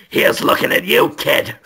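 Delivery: whispered creepy Dafoe; Willem Dafoe voice. Retold or imitated. Eye in the lawyer's backside speaks as Willem Dafoe, whispered. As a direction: breathe it like Dafoe. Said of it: "It's Willem Dafoe's voice".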